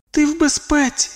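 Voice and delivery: put-on very high girlish comfort; reassuring girl. A narrator's reading. Girl telling her frightened friend he is safe, reassuring. Kalisha reassures him by thought.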